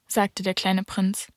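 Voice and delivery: plain reading voice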